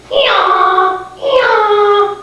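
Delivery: high, loud imitated hee-haw; donkey bray. Storyteller voicing a mixed-up cow, loud donkey bray. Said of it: "And then the cow said".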